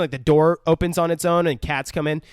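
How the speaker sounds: normal talking voice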